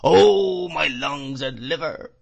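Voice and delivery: loud high wail, sinking as it goes; crying out whiningly. A narrator's reading. Old man appalled at the boy's price, wailing cry. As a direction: burst out on a long first word. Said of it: "cried the old man".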